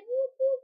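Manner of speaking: high, loud double whoop; celebratory whoops. Real, not acted. Vlogger celebrating her new iPhone, loud high whoops.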